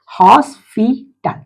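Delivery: Hospital said slowly, hos-pi-tal; teacher stretches hospital into three slow beats; slow syllable split. Shown as a demonstration. Teacher to class, splitting hospital slowly. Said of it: "try syllable word".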